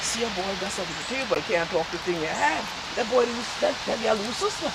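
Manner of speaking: low, soft voice of a reluctant father; hushed, low confession; confiding father. Acted. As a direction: break it to the son quietly and low. Father confides awkward secret to son.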